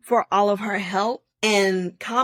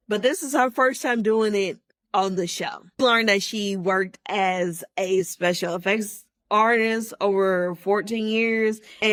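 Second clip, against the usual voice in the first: usual speaking voice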